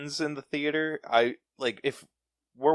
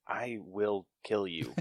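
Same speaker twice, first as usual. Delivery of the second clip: slow, soft mock menace; retold quiet threat; 3 semitones lower, 8 dB quieter, slower than usual. Moviegoer at woman with shoes off, mock seething threat. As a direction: say it low-key, word by word. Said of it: "I'm like".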